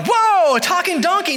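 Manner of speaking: loud, very high amazed exclamation; mock astonishment. Imagined Balaam hearing his donkey talk, astonished. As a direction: burst out in hammed-up amazement. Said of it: "Balaam doesn't go like".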